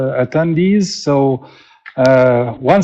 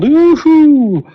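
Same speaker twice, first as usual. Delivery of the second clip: high, loud woohoo; excited whoop; 11 semitones higher, 5 dB louder than usual. Real, not acted. Host cheering viewers from everywhere in the world, excited whoop. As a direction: whoop it up for the crowd.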